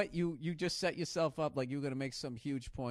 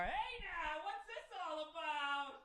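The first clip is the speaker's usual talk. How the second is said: high, softer, slow Cosell-style announcer; Howard Cosell voice; 11 semitones higher, 8 dB quieter, slower than usual. Retold or imitated. Friend in a sport coat doing Howard Cosell, high slow mock announcer. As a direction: drawl it like a vintage sportscaster. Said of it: "dressed like Howard Cosell".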